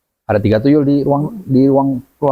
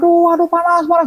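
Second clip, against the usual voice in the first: mock pained screaming. The tuyul screaming from the heat, high mock cries. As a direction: wail like a burning little spirit. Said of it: "teriak-teriak".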